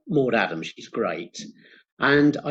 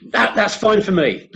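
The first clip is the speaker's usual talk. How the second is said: laughing; 6 semitones higher, 3 dB louder than usual. Real, not acted. Laughing at a bikini comment.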